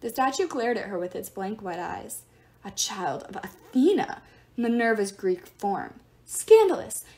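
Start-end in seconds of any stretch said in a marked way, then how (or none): 2.6-7.0 disdainful. Acted. Statue god disdainful.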